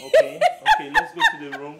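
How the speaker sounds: high, loud, giggly; excited laughing. Acted. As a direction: squeal it with laughter.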